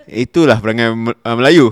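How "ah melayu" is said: ordinary delivery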